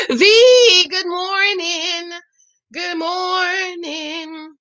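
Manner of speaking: high, slow sing-song hello to a pet; cooing greeting. Real, not acted. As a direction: coo good morning slowly.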